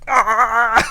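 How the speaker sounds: loud, high mock scream; comic panicked yell; freaking out. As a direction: yell as if your arm went wild. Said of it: "freaking out".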